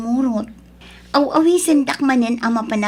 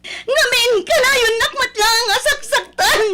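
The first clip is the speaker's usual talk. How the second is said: much higher crying yell; hysterical shouting; 10 semitones higher than usual. Acted. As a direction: scream through tears, out of control. Wife screams at husband in fight.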